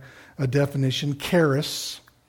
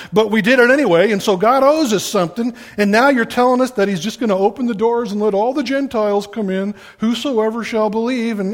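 higher, louder, fast whiny protest; whining complainers; 5 semitones higher, 4 dB louder than usual. Retold or imitated. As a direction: ham up the indignant grumbling.

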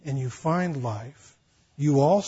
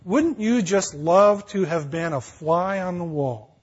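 ordinary talk

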